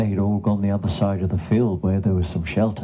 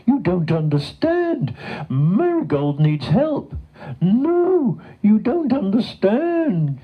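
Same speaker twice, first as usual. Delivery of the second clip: high, drawn-out protesting voice; desperate cow; 13 semitones higher, slower than usual. A narrator's reading. Cow telling the farmer her friend needs help, desperate. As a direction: plead and wail in a high cow voice.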